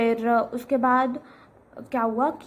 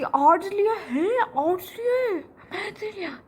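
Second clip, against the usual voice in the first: mock disbelief; 10 semitones higher than usual. Retelling the guest's reaction with high mock disbelief. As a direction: mimic the guest's shocked question. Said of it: "ये epic था".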